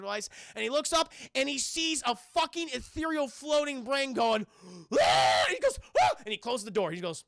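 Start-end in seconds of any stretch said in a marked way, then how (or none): 4.9-5.4 loud mock monster cry; loud brain wail. Floating brain makes its noise. 6.0-6.1 short high yelp of fright; startled mock scream. Character startled by the brain.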